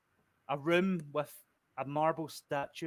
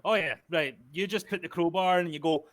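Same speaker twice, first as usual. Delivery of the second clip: normal talking voice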